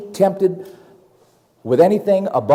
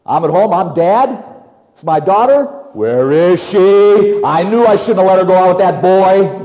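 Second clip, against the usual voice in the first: anxious father pacing at home; worried dad; 3 semitones higher, 5 dB louder than usual. Retold or imitated. Preacher playing a worried dad waiting up for his daughter. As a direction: fret like a dad whose daughter is out late.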